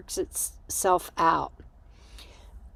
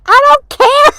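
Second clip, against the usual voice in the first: very high, loud mock yell; imitated dismissive shout; 15 dB louder than usual. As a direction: yell it back, comically uncaring.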